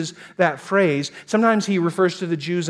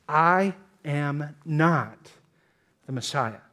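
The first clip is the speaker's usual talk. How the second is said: "I am not" drawn out slowly, each word weighted; slow, deliberate stress on "not"; emphatic denial; much slower than usual. Acted. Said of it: "John is putting it in an emphatic form".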